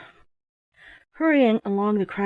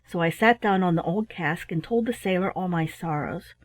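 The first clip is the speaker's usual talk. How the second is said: ordinary delivery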